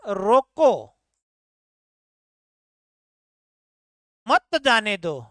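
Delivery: "रोको" alone, a very long stop, then "मत जाने दो" as one falling phrase; long comma pause before मत; pause after रोको. Shown as a demonstration. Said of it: "यहाँ विराम चिन्ह का प्रयोग कर दिया".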